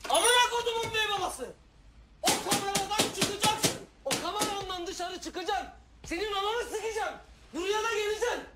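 high, loud furious threats; enraged yelling. Acted. Calling the captain out of his cabin, enraged yelling. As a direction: scream it up at someone shut away.

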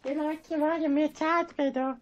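lightly voiced Elmo; squeaky little puppet voice. Shown as a demonstration. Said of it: "It sounds like Elmo".

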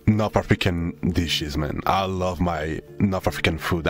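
ordinary talk

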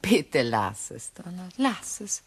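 slow, soft refusal; tired, pushing pity away; weary grief. Acted. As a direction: widow begging quietly. Grieving widow refuses condolence.

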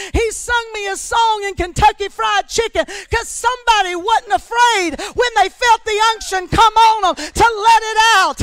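loud, driving sermon voice; shouting preacher in full flow; full-force preaching. Acted. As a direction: preach it hard to the congregation.

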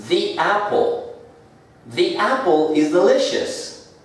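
high-pitched, "the" with a long ee before apple; "the" as "thee". Shown as a demonstration. Teacher showing the article before a vowel, "the" as "thee". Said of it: "Como la siguiente también es vocal".

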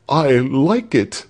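high, slow, strained liking; forced agreement. A narrator's reading. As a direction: pretend to like it under threat. Cowed victim feigns liking taxes.

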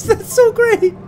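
high laughing delight. Real, not acted. Laughing at skid marks.

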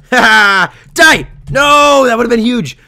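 loud, high cry turning to dismay; excited shouting. Real, not acted. Player narrowly missing a kill, loud excited dismay. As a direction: shout the attack, then the disappointment.

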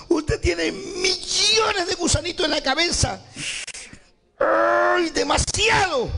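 high, drawn-out squeal of dismay; acted high-pitched disgusted shock; horrified exclamation. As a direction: voice a girl appalled by the sight. Girl voiced seeing maggots in wound.

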